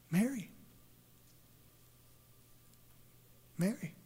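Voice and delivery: soft, questioning-pitched call of a name; hushed call from behind; gentle calling. Acted. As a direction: call the name softly, lifting at the end. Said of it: "she hears a voice from behind her".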